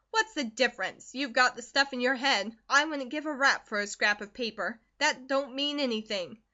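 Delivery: loud, scornful shrug of a voice; dismissive mother. Acted. Mother scorns the diploma, loud and dismissive. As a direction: wave it all away. Narrator voices Mrs Comstock.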